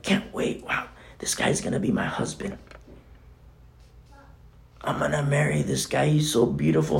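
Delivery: hushed, breathy bride-to-be; dreamy bride. Retold or imitated. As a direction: swoon over her husband-to-be. Voicing an excited bride.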